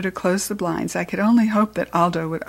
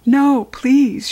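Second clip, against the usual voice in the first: pleading, a touch of fear; frightened girl pleading with teacher; frightened plea; 6 semitones higher, 6 dB louder than usual. Acted. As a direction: beg her not to, scared. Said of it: "very frightened".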